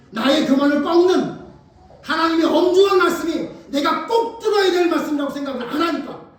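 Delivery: loud, high-pitched rebuke from the pulpit; full-force preaching. Real, not acted. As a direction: drive each phrase home at full volume.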